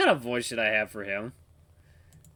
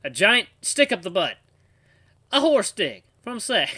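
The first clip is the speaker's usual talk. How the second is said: put-on southern accent; 10 semitones higher, 8 dB louder, slower than usual. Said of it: "telling me to make something up".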